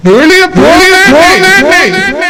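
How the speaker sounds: loud, high furious yell, last போங்க drawn out slowly; furious shout. Acted. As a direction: scream at them to get out. Son explodes, orders everyone out. (கோபத்தில் கத்துதல்)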